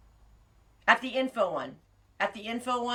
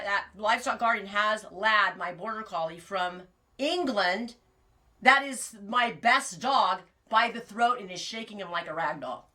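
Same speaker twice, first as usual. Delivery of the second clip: usual speaking voice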